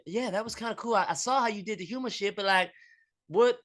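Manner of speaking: high, flustered barista voice; barista girl again. Retold or imitated. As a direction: lightly play the barista cutting in. Barista girl answers back.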